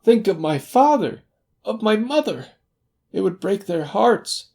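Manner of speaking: high, loud, desperate begging; terrified little man imploring; shrieking plea. A narrator's reading. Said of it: "he shrieked".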